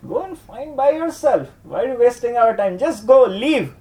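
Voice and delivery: high, sharp brush-off; scolding friends. Acted. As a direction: shoo him away, high and fast. Friends scolding Krishna away.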